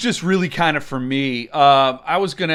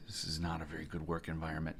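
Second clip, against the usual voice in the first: muttered aside; 13 semitones lower, 21 dB quieter, faster than usual. Real, not acted.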